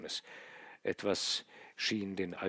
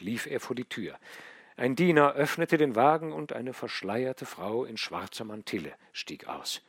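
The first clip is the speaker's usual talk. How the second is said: ordinary delivery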